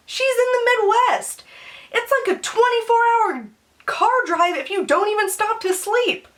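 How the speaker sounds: high-pitched incredulous rant; exasperated. Real, not acted. Exasperated at her own pointless call.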